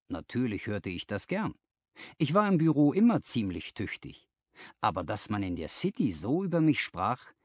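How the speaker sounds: ordinary delivery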